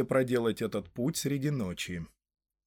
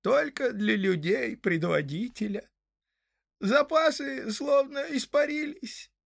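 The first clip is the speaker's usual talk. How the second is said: high-pitched, doleful hobbit voice; rueful hobbit; 9 semitones higher than usual. Acted. Hob tells the travellers where tobacco went, high and rueful.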